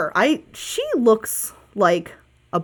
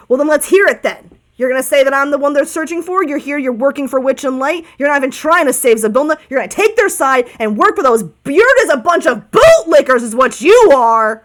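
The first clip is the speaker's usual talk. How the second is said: loud, higher accusing rant; dude-voiced bird; 4 semitones higher, 6 dB louder than usual. Cornered bird accusing the party of siding with Witch and Light, loud scorn. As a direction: build to a scornful, indignant outburst. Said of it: "I have to do a dude voice".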